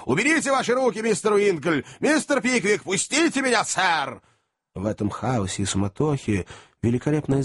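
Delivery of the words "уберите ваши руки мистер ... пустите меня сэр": high-pitched outraged demands; shouting old man. A narrator's reading. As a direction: yell as if struggling to break free. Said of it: "кричал старик".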